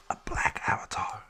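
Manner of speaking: hushed, breathy whisper; whispered aside. Real, not acted. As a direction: drop to a secret whisper. Aside about a new Avatar.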